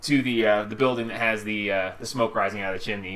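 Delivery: normal talking voice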